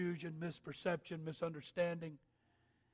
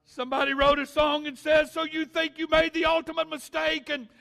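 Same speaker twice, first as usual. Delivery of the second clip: shouted, much higher and louder preaching; preaching at full force; 12 semitones higher, 18 dB louder than usual. Acted. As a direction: preach it at the top of your lungs. Preacher at climax.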